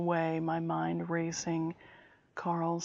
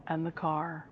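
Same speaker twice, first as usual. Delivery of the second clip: ordinary delivery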